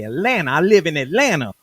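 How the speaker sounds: imitating [name] saying Atlanta, a Southern twang. Telling about Pimp: a lightly mimicked Southern rapper voice. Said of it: "the way Pimp would say".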